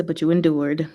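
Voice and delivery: ordinary talk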